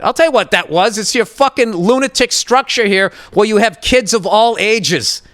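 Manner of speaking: usual speaking voice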